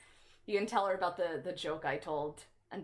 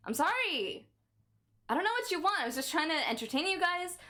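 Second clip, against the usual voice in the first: high, louder whiny apology; high pleading; 10 semitones higher, 3 dB louder than usual. Real, not acted. Streamer begging viewers who left, high and whiny.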